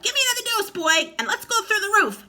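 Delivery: put-on screechy old grandma from the attic. A narrator's reading. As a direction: drag it out like a cackling hag. Grandma demanding.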